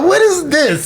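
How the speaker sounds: put-on high, laughing kid voice; startled daughter voice. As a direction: squeal it like a surprised kid. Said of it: "She gonna be like".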